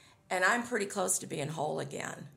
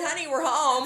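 playful announcement; 9 semitones higher, 7 dB louder than usual. Retold or imitated. Retold announcement to husband's ashes.

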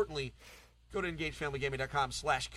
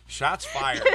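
laughing; 5 semitones higher, 4 dB louder than usual. Real, not acted.

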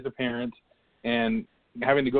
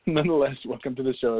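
usual speaking voice

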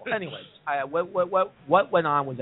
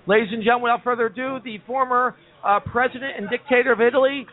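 announcer intro; 7 semitones higher than usual. Acted. Host announcing a guest.

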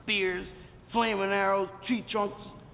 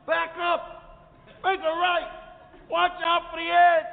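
goofing directions; 7 semitones higher, 5 dB louder, slower than usual. Retold or imitated. Chris goofing, giving directions.

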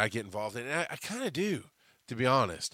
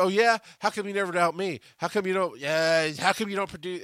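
mock-challenging wife, pitched up; teasing wife; 6 semitones higher, 4 dB louder than usual. Wife teasing her husband about producing, mock-challenging. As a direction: poke at him like his teasing wife.

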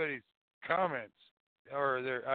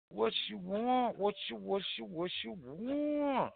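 goofy teasing sing-song; silly voice; 6 semitones higher, 5 dB quieter than usual. Pretend.

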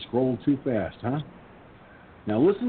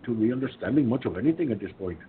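put-on Indian accent; 2 semitones higher than usual. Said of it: "when I did the Indian voice".

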